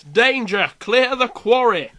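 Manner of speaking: loud northern English shout; northern accent. Acted. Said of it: "Why is that in a northern accent?".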